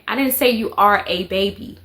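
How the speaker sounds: ordinary talk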